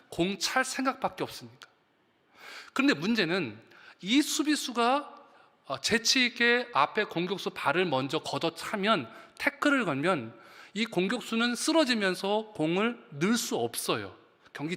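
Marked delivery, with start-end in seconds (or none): none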